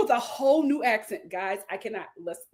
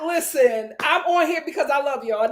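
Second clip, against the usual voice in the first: ordinary talk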